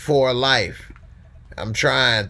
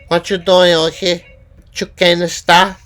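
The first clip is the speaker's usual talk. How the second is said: much higher, loud acted confrontation; loud character impression; 8 semitones higher, 11 dB louder than usual. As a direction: call him out like a TV gangster. Acted TV show scene impression.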